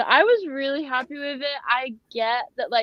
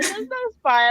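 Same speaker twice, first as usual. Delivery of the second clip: high-pitched incredulous quote; mock disbelief; 11 semitones higher than usual. Fan with suspect list learns the truth, mock disbelief. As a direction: pitch it right up, as if baffled. Acting out losing mind over spy.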